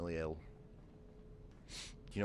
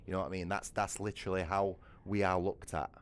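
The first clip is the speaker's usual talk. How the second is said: ordinary talk, nothing marked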